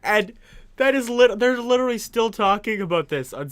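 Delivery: ordinary delivery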